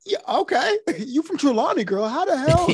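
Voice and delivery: high, teasing Jamaican patois; Jamaican accent. Retold or imitated. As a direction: call her out in Jamaican patois. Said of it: "He's like".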